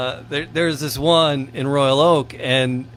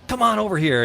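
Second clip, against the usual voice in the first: higher-pitched friendly summons, retold; raised-pitch quote of someone beckoning; retold beckoning call; 9 semitones higher than usual. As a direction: imitate an owner waving a customer over. Retelling shop owner beckoning customer over.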